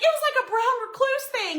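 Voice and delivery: high-pitched flustered explaining; re-enacted fright. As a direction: act out panicky insisting. Retells frantic report to husband.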